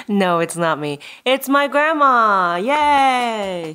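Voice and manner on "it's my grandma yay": slow, high, cheering reveal; excited. Real, not acted. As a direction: announce her with glee. Introducing grandma on the podcast.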